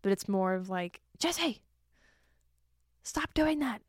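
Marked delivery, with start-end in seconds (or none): 1.2-3.8 mom's mild scolding. Retold or imitated. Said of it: "my mom does yell at me".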